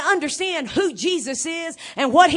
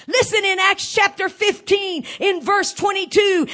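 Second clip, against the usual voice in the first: usual speaking voice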